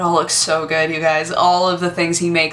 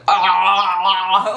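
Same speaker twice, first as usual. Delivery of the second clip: excited squeal; 2 semitones higher than usual. Retold or imitated. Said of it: "like, so excited".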